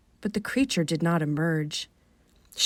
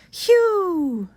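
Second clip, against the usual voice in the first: high falling whistled "whew"; mystery bird call; 12 semitones higher, 3 dB louder than usual. Acted. Narrator imitating the strange bird call.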